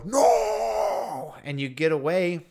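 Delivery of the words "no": high, long mock wail; villain's drawn-out "no". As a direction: imitate a thwarted villain's howl, not full volume. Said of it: "you hear him go".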